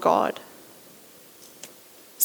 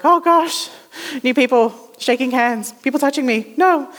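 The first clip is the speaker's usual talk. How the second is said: higher-pitched mock dread at meeting people; dreading introvert; 4 semitones higher than usual. Introvert asked to be a greeter, comically dreading it. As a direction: play a rattled introvert, voice pitched up. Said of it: "for an introvert, that's like".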